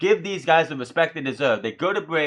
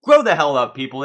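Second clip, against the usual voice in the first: loud fed-up yell; fed-up yell at the fans; angry shout; 8 dB louder than usual. Real, not acted. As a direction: shout the crowd down. Ranting at beach ball fans.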